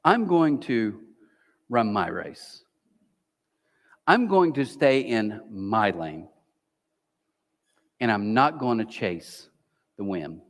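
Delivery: slow, low, deliberate vow; slow resolve. Shown as a demonstration. Pastor modelling a calm vow for the congregation, slow and low. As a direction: say it slowly, settling yourself. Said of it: "What if you said this?".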